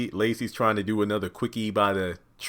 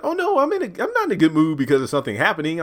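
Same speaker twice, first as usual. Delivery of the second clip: high, quick, louder voice; mock excited Lacey; 8 semitones higher, 5 dB louder, faster than usual. Lacey explaining to the kitchen women, mock excited. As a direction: gush it in a put-on high voice.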